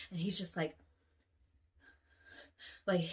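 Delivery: usual speaking voice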